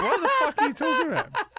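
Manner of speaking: high, loud, incredulous yelp; baffled outburst. Retold or imitated. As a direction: shoot it out in disbelief. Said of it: "So I was like".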